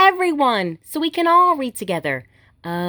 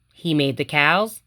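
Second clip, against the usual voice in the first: put-on reading to children; 2 semitones lower than usual. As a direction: read it gently to little kids, each line a small wonder. Reading picture books aloud.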